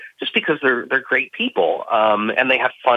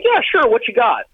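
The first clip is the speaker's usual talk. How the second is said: high mock producer voice; 12 semitones higher than usual. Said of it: "they're like".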